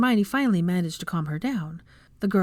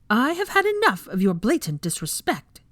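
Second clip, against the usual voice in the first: raised, hard-edged scolding; furious; 5 semitones higher than usual. A narrator's reading. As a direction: let the anger spill out at the class. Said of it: "she said furiously".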